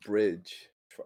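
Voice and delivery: normal talking voice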